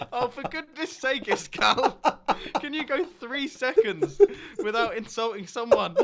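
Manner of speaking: exasperated, half-laughing plea; laughing exasperation. Real, not acted. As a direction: tell off a friend in amused despair. Scolding co-host, half laughing.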